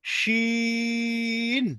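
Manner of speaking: শিন drawn out long with a thick palatal hiss; long thick shin. Shown as a demonstration. Teacher modelling শিন against সিন. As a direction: stretch শিন fuller and heavier. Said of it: "একটা হালকা একটা মোটা".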